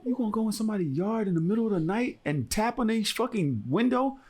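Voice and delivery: high, outraged retold question; incredulous scolding. Acted scolding of intruder over money.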